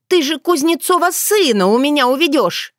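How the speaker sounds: put-on high, whiny girlish accusation; suspicious village girl. Dunya suspecting the yaga of stealing her man, high and whiny. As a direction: pitch it high, accusing a rival.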